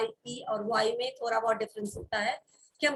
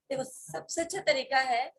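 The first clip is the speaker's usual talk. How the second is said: usual speaking voice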